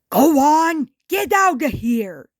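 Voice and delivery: loud shout to send him off; yelling. Acted. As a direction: shout it after the leaving car. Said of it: "yelled Wren".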